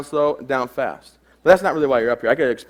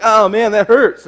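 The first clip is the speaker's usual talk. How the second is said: high, loud, slow mock whimper; hammed-up boy in pain; 7 semitones higher, 7 dB louder, slower than usual. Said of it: "He's like".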